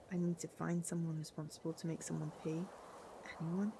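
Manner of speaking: ordinary talk, nothing marked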